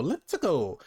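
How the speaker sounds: hammed-up Italian cartoon catchphrase, leaping up in pitch; exaggerated Mario. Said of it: "a exaggerated Mario".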